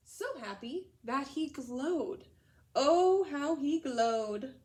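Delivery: slow, wondering storybook reading; slow, marvelling read-aloud to children. A narrator's reading. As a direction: savor the glow, very slow. Reads the story aloud to class.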